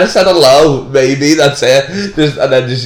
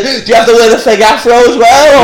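amused mock question, higher and louder; laughing mock question; 7 semitones higher, 6 dB louder, faster than usual. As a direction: put the question through laughter. Mocking question through laughter.

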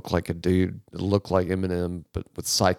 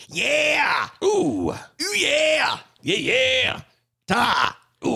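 high shouted metal yelps; [name] yells; much slower than usual. Retold or imitated. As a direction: belt out rock-singer yeahs. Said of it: "nothing but James going".

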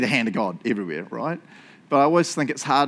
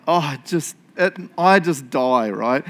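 normal talking voice

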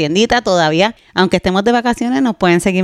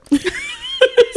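breathless high-pitched giggle; relieved laugh after the scare; 3 dB quieter than usual. Real, not acted. Cohost laughs off her fright, high giggle.

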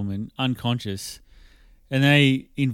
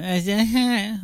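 high-pitched squealing moans; high anime woman squeal; 9 semitones higher than usual. Retold or imitated. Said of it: "the woman going".

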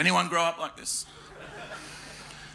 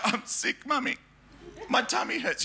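put-on higher, softer, breathy little-boy whimper; pitiful sick child; 6 semitones higher, 3 dB quieter than usual. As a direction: play the poorly child begging to stay home. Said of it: "I'd be like".

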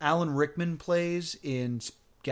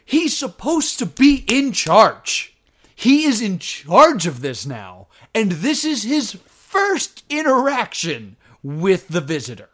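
louder, exasperated emphasis; 5 semitones higher, 10 dB louder than usual. Real, not acted. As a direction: hammer the point home. Emphatic rant.

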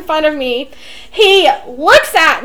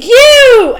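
higher, louder shouted You; retold scream; 6 semitones higher, 6 dB louder than usual. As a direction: yell it at the singer on stage. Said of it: "I literally screamed".

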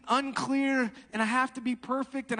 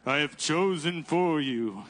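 low, slow, regal voice from heaven; mock Charlton Heston God, low and slow; booming voice of God; 7 semitones lower, slower than usual. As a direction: play God handing down a verdict, slow and deep. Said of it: "the booming voice of, like, God".

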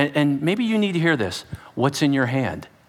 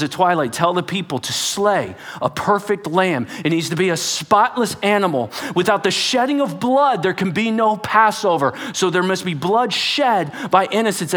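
ordinary delivery

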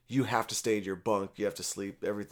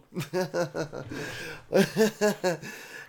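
laughing; 5 semitones higher than usual. Real, not acted. Laughing at own jail story.

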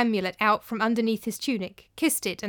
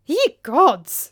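startled oath; 5 semitones higher, 8 dB louder than usual. Acted. Master startled at ghost warning.

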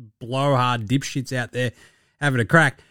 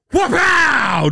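high, explosive mock impact sound; shouted impact noise; 13 semitones higher, 3 dB louder than usual. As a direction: burst out a high smack noise. Imitates ball smashing into face.

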